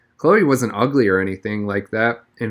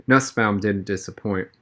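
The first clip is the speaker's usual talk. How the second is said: ordinary delivery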